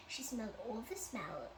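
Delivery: usual speaking voice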